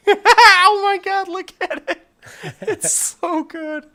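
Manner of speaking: laughing. Real, not acted. Laughing at the crate-fall gif.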